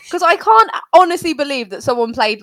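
usual speaking voice

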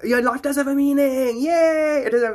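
high, loud joyful exclaim; excited cheer. Real, not acted. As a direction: cheer it out, thrilled. Cheering that life has meaning.

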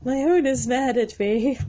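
high-pitched mock-anxious horse; mock panic, starting high and easing down; worried horse. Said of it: "how some horses are like".

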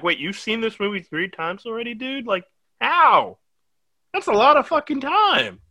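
high-pitched, baffled disbelief; retold amazement, voice climbing high; incredulous. Reacting to a friend's third rewatch, incredulous.

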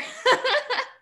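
high burst of laughter; real laugh. Real, not acted. Guest praising host as Academy Award mother, laughing. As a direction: laugh out loud, pitched high.

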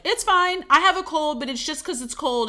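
high, loud mock brush-off; mock dismissive. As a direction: play someone insisting nothing is wrong. Voices people dismissing their body's signals.

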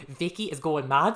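slow, loud Geordie impression, laughing; Geordie accent. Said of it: "They're like".

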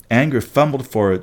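plain reading voice

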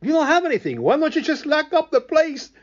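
high, loud fed-up customer; exasperated outburst. Acted. Customer yelling at the empty store, exasperated. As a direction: throw up your hands and yell it.

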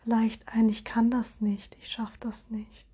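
ordinary talk

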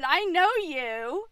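put-on playful, high, loud old lady, drawn out; old lady twin. Twin greeting her look-alike sister in rocking chairs, hammed-up old lady. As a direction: draw it out loudly in mock recognition. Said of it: "two old ladies who are twins".

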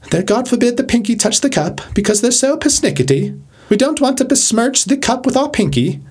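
put-on high, fussy English royal accent. Royals at tea with pinky up, persnickety English accent. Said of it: "this English person who's of the royal family".

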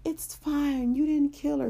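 high, gentle little teddy bear voice; the teddy soothing the boy in a high voice; Teddy's voice. Acted. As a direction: comfort him in a high toy-bear voice. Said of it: "And Teddy's like".

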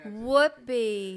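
whoopee drained of its joy; joyless imitation. Copying the recording to a co-host, joyless imitation. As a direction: echo a lifeless recorded cheer. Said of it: "taking all the joy out of it".

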